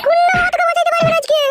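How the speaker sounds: high, loud threat; furious shout. Acted. Wife warning her husband no one can save him, furious high shouting. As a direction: shout it with no mercy.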